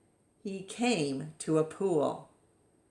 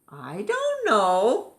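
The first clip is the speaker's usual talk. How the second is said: slow, high, louder puzzled reply; confused answer; 5 semitones higher, 5 dB louder, slower than usual. Acted. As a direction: drag it out, baffled. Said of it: "the elephants answered".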